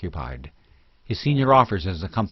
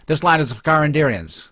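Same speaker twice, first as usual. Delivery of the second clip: hostile declaration; 7 semitones higher, 5 dB louder than usual. A narrator's reading. Mob man declares ownership.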